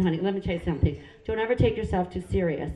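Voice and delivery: ordinary talk, nothing marked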